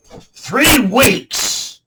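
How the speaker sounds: loud, high burst of disbelieving rage; furious, high-pitched exclamation; outraged shout. Acted. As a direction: roar it like a raging warlord. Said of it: "the rat leader had raged and sworn".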